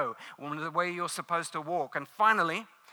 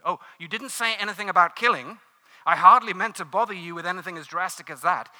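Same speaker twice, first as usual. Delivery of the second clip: alarmed man; 4 semitones higher, 12 dB louder than usual. A narrator's reading. Man's voice in read story.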